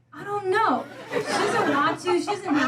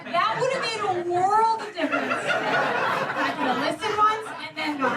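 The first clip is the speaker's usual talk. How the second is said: ordinary talk, nothing marked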